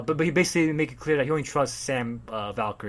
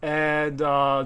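normal talking voice

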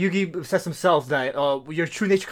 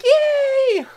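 very high, louder squeal; gleeful Yugi; 5 dB louder than usual. Retold or imitated. Yugi thrilled to borrow the video, high squeal. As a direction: squeal it like a delighted kid.

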